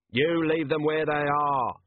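a mild, drawn-out shout; restrained shout. A narrator's reading. Vimes countermanding the captain, restrained shout. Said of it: "shouted Vimes".

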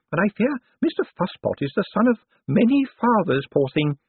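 put-on high old woman's voice, amused gossip; feisty old lady. A narrator's reading. Old lady tells of the dog.

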